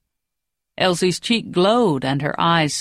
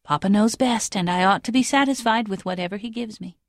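to herself; 4 semitones higher than usual. A narrator's reading. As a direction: comfort yourself, half to yourself. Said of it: "saying to herself".

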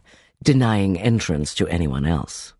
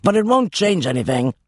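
growling innkeeper; 9 semitones higher than usual. Acted. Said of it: "the man growled".

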